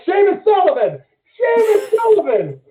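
high-pitched, re-enacted holler; screaming a name. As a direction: yell for a friend down the hallway, voice way up. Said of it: "screaming his name".

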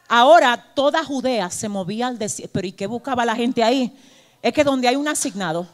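ordinary delivery

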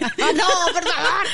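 high, loud laughing protest; cracking up. Real, not acted. Co-hosts beg each other to stop, cracking up. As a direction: plead through helpless laughter. Cracking up at the comedy-duo comparison.

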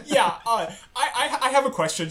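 high, laughing through the words; can't stop laughing while asking. Real, not acted. Laughing at the dentist joke.

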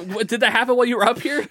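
high, fast disbelieving question; incredulous question. Retold or imitated. Worker questions the injured old woman, high and fast. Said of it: "I'm like".

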